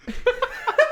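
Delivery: very high laughing burst; high squealing laugh. Real, not acted. Laughing at own joke.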